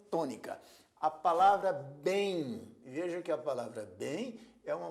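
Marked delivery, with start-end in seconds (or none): 1.7-2.8 "bem" drawn out with force; stressed monosyllable. Shown as a demonstration. Teacher showing a stressed monosyllable, "bem" said forcefully. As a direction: give "bem" full stress, held long. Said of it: "bem é pronunciada com força".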